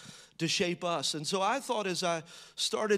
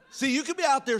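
laughing; 7 semitones higher, faster than usual. Real, not acted. Preacher to congregation after his joke, laughing. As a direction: still cracking up at his own joke.

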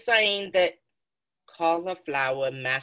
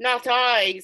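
put-on town drunk; 6 semitones higher than usual. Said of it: "said the drunkard".

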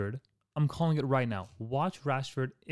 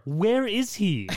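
incredulous; 8 semitones higher, 5 dB louder, much slower than usual. Real, not acted. Incredulous about missing player.

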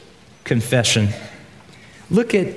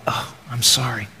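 breathy whispered sorry to the Lord; hushed confession in prayer; whispered apology; 4 semitones lower, 5 dB quieter than usual. Acted. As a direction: drop to a whisper, contrite.

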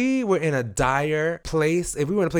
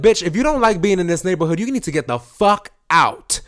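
louder, higher, telling him off; retold angry shout; 5 semitones higher, 6 dB louder than usual. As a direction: yell at the man to get out. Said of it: "I said".

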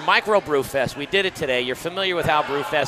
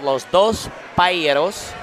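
Spanish-accented PAELLEROS, with a light tapped R; 4 dB louder, slower than usual. Shown as a demonstration. Co-host reads a paella stand's sign aloud, Spanish accent. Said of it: "if you wanna say it with an accent".

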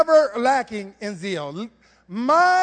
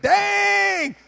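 amazed; 6 semitones higher, 4 dB louder than usual. Retold or imitated. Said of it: "You be like".

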